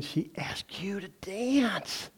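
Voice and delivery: soft, slow whispered aside; hushed confiding whisper. Real, not acted. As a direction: drop to a whisper like sharing a secret. Aside about shy dance memory.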